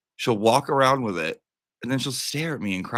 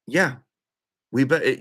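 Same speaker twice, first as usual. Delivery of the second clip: ordinary talk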